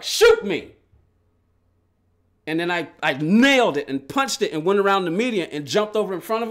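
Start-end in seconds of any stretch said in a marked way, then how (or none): 0.0-0.7 high, loud snapped dare; defiant shout. Retold or imitated. Retold road rage, daring the gunman. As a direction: throw the dare right in his face. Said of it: "I was like".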